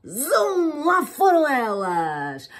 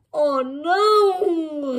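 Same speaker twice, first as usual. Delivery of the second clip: grumbling; 9 semitones higher, 6 dB louder, much slower than usual. Acted. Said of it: "resmungou a Eva".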